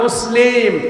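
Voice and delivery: ordinary talk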